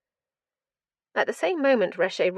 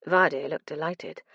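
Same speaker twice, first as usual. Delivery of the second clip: plain reading voice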